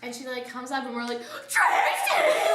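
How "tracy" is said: high, loud, laughing squeal; giggly retold shout; excited calling out. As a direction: shriek a friend's name in greeting. Calling out to arriving friend.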